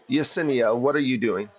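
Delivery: ordinary talk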